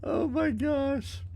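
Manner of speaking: high, teary laugh, slow and breathless; crying with laughter over a pun. Real, not acted. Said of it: "I'm still laughing and crying over grains".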